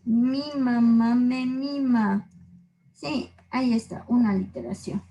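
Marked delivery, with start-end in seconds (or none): 0.0-2.3 demo. Shown as a demonstration. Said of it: "el ejemplo más típico es el que les puse".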